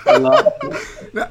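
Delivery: high, slow, laughing through it; can't stop laughing. Real, not acted. Laughing about the memory.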